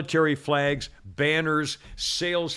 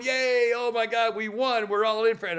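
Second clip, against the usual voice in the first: higher-pitched imitation of gullible celebration; mock cheering; 7 semitones higher than usual. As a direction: mimic an overjoyed crowd. Mimics voters cheering health care promise.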